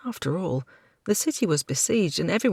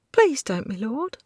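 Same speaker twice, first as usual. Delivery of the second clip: tearful plea; 9 semitones higher than usual. Acted. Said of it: "she whispered as tears welled".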